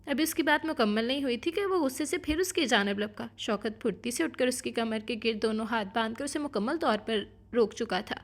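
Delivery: ordinary delivery, nothing marked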